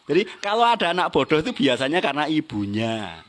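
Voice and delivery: ordinary talk, nothing marked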